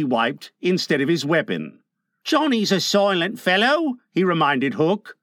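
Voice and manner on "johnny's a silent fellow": higher-pitched henchman; a pleading question in a raised character voice; pirate Smee. A narrator's reading. Smee offers his cutlass to Hook, high henchman voice.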